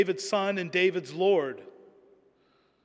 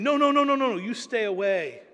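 sharp, much higher and louder no-no-no, easing on stay away; shooing away; 6 semitones higher, 5 dB louder than usual. Retold or imitated. Preacher voicing people driving the leper away, loud and high. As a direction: push the unclean man back hard.

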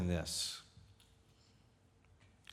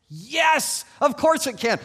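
high, loud, exuberant yes; resounding, excited reassurance; emphatic delighted answer; 12 semitones higher, 8 dB louder, slower than usual. Acted. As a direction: burst out with the good news. Preacher answers the little girl's question.

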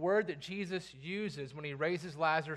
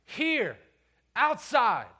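loud, high imperative; sharp command; 5 semitones higher, 7 dB louder, much slower than usual. Acted. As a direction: order it like a command that must be obeyed. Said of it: "they come across more like this".

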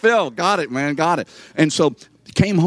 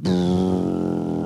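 engine rumble; 5 semitones lower than usual. Retold or imitated. Machine starts running.

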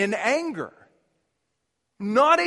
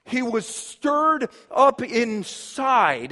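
ordinary talk

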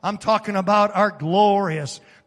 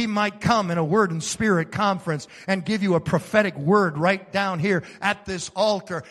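ordinary delivery

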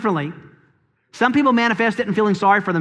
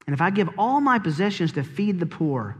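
normal talking voice

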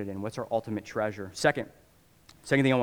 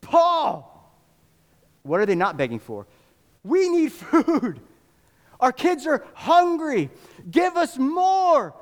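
much higher, loud, slow pleading cry; begging believers; 7 dB louder, slower than usual. Retold or imitated. Macedonian believers beg Paul for help, high slow pleading cry. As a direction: plead with Paul as if on your knees. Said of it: "begging, begging, saying".

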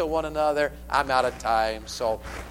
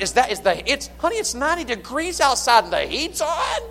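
incredulous husband; 10 semitones higher, 4 dB louder than usual. Retold or imitated. Husband protests the heat is on.

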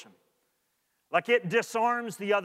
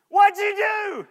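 high, louder, laughing; amused laughing question; 10 dB louder, slower than usual. Shown as a demonstration. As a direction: laugh it off playfully. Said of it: "one response to the second".

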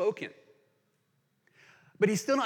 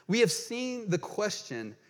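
ordinary talk

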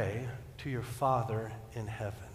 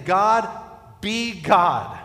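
loud, raised cry to God; emphatic prayer; 6 semitones higher, 9 dB louder, much slower than usual. Acted. Preacher putting the prayer in other words, emphatic. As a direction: hit each word hard, pausing after God. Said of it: "In other words, we're saying".

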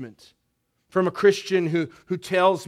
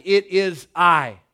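"I" drawn out and weighted, slow and louder; emphatic I; 2 semitones higher, 7 dB louder, slower than usual. Shown as a demonstration. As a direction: lean hard on "I". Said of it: "it's emphatic".

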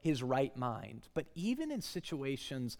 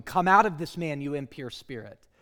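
commanding; 8 dB louder than usual. Acted. Jesus commands the spirit.

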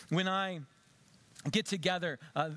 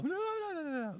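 high squealing mock gushing; 12 semitones higher than usual. Pastor mimicking the bubbly bride, high squeal.

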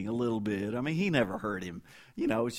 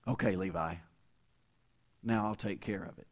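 softer, lower, calm reassurance; reassuring parent; 4 semitones lower, 4 dB quieter than usual. Retold or imitated. Preacher voicing a parent calming his hurt son, softer and lower. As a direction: soothe the boy and promise to handle it. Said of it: "And then say".

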